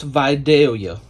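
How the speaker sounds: Vidalia with a long "vye" first syllable; Georgia pronunciation. Shown as a demonstration. Teaching viewers how to pronounce this, Vidalia said vye-DALE-yuh. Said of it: "how to pronounce this".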